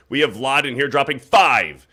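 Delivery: ordinary talk, nothing marked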